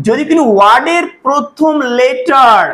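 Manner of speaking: slow, stressing কোনো; a slow teacher's rule; slow emphatic reading. Shown as a demonstration. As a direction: read the rule slowly for students. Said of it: "তো এখানে বলছে দেখো".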